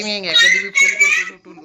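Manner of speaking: high, slow mocking falsetto; mock squeaky voice. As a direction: squeak it out slowly to tease him. (उंच, हळू, चिडवणारा किरकिरा आवाज)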